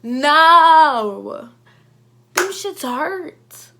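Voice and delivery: loud, high, slow outburst; outraged. Real, not acted. Telling viewers about the phone smack, loud outraged outburst. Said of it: "I was so mad".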